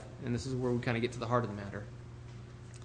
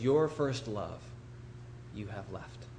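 "love" drawn out, pause, then "you have left" much softer; stress on love; 3 semitones lower, 6 dB quieter, slower than usual. Shown as a demonstration. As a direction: set "love" apart, then drop low and quiet. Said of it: "Putting the emphasis on the love".

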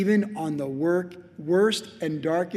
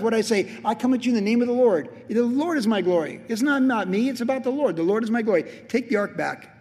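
high, loud, urgent sermon voice; impassioned preaching; 5 semitones higher, 4 dB louder than usual. Acted. Preacher as David giving God the glory, impassioned preaching. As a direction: declare it fervently to the whole church.

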